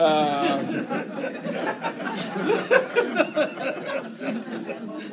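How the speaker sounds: laughing out loud at himself; hearty laugh. Real, not acted. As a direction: burst into a real laugh. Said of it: "preached on a lot of stuff I didn't understand".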